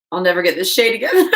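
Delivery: giggling despair; laughing. Real, not acted. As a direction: laugh through the words. Laughing at own mixing.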